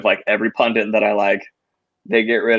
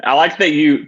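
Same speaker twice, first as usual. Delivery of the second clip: ordinary talk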